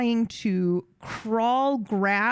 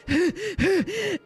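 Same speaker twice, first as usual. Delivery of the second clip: sharp high panting gasps; effortful gasps; 9 semitones higher than usual. Retold or imitated. Pulling herself out of tar, straining gasps. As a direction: gasp with the strain of hauling yourself up.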